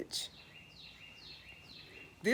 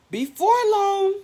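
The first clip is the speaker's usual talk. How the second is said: much higher, loud exclamation; excited storyteller; 12 semitones higher, 9 dB louder than usual. Acted. Storyteller to children, sudden excited turn. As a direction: burst in with excitement.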